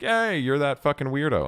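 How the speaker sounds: high-pitched shout of recognition; excited fan approaching. Retold or imitated. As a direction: imitate a fan spotting someone they know. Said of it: "people will come up to you and be like".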